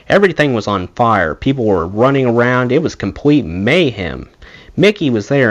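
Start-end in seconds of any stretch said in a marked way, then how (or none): none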